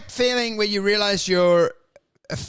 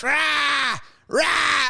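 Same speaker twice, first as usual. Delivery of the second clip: crow squawk; 8 semitones higher than usual. Retold or imitated. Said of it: "they were just".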